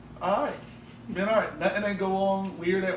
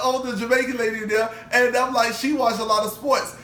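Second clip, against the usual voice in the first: usual speaking voice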